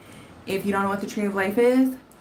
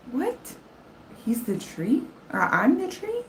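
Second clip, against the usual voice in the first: high, incredulous questions; baffled what; 7 semitones higher than usual. Retold or imitated. Baffled by the TikTok psychic, high incredulous questions. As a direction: squeak out each question in disbelief.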